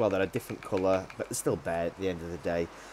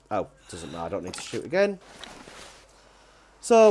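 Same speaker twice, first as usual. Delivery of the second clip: usual speaking voice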